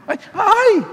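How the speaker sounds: high squealing mock panic; mock frightened cry. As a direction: squeal like the mugger just grabbed you. Imita a la víctima asustada. (chillido fingido de miedo, muy agudo)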